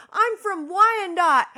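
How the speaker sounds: high, loud, slow yell; shouted. Retold or imitated. Neighbor shouting her hometown across the courtyard, loud. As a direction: call it proudly across the yard. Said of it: "screaming across the courtyard at each other".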